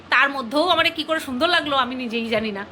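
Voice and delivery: ordinary talk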